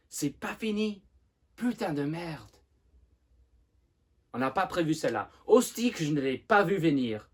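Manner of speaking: loud, high, exasperated outburst; furious frustration. Acted. Simone rails at his mother's last surprise, loud and furious. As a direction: punch out each bitter surprise, building to a shout. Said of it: "exprime sa rage et sa frustration".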